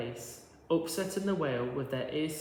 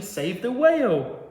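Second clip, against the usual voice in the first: loud, higher cry for help; urgent plea; 7 semitones higher, 9 dB louder than usual. A narrator's reading. The snail's silver trail calling for help, louder and higher. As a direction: proclaim it like a banner.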